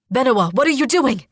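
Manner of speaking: fast, high, loud alarm; alarmed shout. Acted. Brother alarmed at sister.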